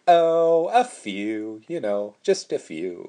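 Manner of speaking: sugary Southern drawl, opening high and loud; sweet Southern accent. Retold or imitated. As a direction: put on a sweet Southern voice, starting big on a drawn "oh". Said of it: "puts on this sweet Southern accent".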